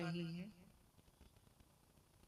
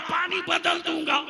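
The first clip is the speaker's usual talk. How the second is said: maulvi's shouted, angry reply, much higher; angry retort; 13 semitones higher, 16 dB louder than usual. Retold or imitated. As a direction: snap back at full shout. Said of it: "गुस्से में मौलवी बोल पड़ा".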